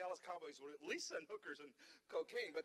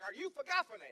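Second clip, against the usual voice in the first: loud, higher, insistent; mad driver; 9 semitones higher, 8 dB louder than usual. Retold or imitated. Big driver confronting the comic, angry raised voice. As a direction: push the question hard, getting angrier. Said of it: "Now the man's mad".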